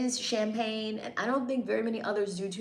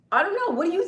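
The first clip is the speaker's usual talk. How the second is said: high teasing exclaim; 9 semitones higher, 8 dB louder, faster than usual. Acted. Teasing a commenter about price.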